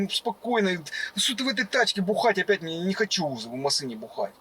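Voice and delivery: ordinary delivery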